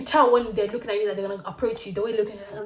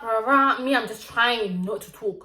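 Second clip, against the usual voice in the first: ordinary talk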